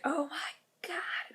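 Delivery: breathless slow whisper of awe; awed whisper. Retold or imitated. Sister to sister at the meet and greet, a starstruck whisper. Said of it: "gives me this, like, big, giant smile".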